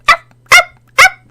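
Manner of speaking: high, loud yappy puppy barks; puppy barking. Acted. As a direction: yap like an excited little dog.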